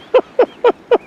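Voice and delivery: high, loud burst of giggles; helpless amused cackle; real laugh. Real, not acted. [name] tickled by a tiny dog called Eric, laughing. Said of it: "permit me a little laugh".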